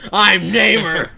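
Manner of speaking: slow, loud, grand superhero intro; hammy villain announcement. Pretend. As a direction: proclaim it like a comic villain.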